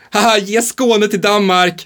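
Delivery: high, slow, hammed-up jokey tone; fake-laughing mock joke. Shown as a demonstration. Quiz example for co-host, high fake-jolly joke. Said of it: "Om jag säger".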